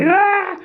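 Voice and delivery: put-on sudden high childish cry; high mock baby protest; mock baby wail. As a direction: squeal like a toddler losing a toy. Baby crying when toy taken.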